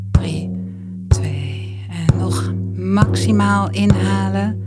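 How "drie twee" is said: normal talking voice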